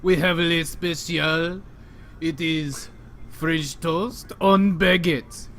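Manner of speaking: slow, hammed-up French accent; a mock French waiter, slow. A mock French chef presents the special, slow French accent. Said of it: "talking in a French accent".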